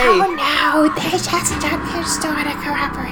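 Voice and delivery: evil voice imitation. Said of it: "I love her evil voice".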